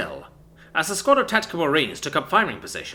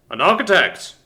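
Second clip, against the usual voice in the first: surprised; 6 semitones higher, 8 dB louder than usual. Acted. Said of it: "eyebrows shot up with surprise and mirth".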